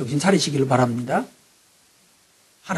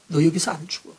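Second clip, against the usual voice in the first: tender reassurance; 7 dB quieter than usual. Retold or imitated. God comforting the fallen John, soft and tender. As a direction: hush him gently, like calming a scared child. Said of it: "주님 말씀하십니다".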